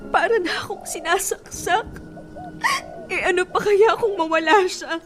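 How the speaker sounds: sobbing through the words; crying. Acted. Heartbroken girlfriend telling cousin she can't let go, crying. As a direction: choke up, barely holding the tears. Still loves cheating boyfriend, heartbroken.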